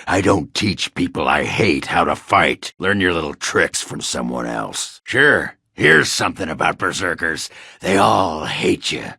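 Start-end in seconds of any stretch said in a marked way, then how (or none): none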